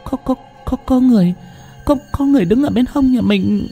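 terrified wife clinging to her husband; broken, halting voice. Acted. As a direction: gasp the words out between sobs. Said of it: "nói bằng giọng dứt quãng".

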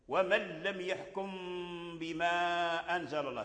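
measured chant-like line, lingering on "يحكم"; ghunnah in recitation. Shown as a demonstration. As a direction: hold the nasal at the end of "يحكم". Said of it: "هذه هي الغنة في".

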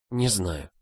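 very soft, timid answer; whispered reply. A narrator's reading. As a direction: murmur it weakly, barely voiced. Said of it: "прошептала в ответ". (тихо прошептать в ответ)

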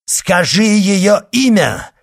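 loud, high commanding shout. A narrator's reading. Monk demands the woman's name, shouting. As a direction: shout the demand at full force. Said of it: "крикнул монах".

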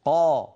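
قا loud and fully emphatic; fullest emphatic qa. Shown as a demonstration. A teacher shows the most emphatic قا. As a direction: hit قا as the heaviest emphasis. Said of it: "هذا أفخم شيء".

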